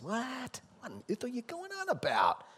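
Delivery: "What?" thrown high in disbelief; high incredulous cry; baffled disciples. Retold or imitated. Confused disciples voiced.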